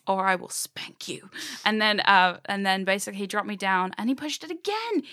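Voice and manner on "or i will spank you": slow playful whisper; whispered mock threat. Telling off a hypnotist, in a slow teasing whisper. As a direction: breathe the threat out slowly, teasing. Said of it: "And I did my dummy face".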